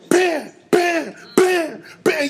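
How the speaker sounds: high, punchy "bam" sound effects; imitated DJ cuts. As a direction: punch out each cut like a DJ. Imitating a DJ's rapid cuts.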